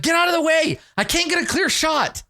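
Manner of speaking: high-pitched mock shout; acted high, indignant holler; shouting in blame. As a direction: yell at a teammate blocking the shot. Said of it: "she shouts at you because she likes to blame".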